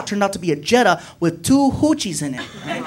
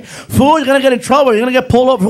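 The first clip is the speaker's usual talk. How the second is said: higher, louder scolding yell; angry yell; 5 semitones higher, 5 dB louder, faster than usual. Retold or imitated. Friend scolding the speeding driver, loud. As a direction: yell the warning at him. Acted car quarrel.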